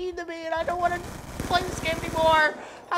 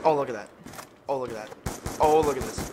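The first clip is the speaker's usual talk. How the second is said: mock deep voice. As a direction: put on a low voice to mock. Mock voice, repeated phrase.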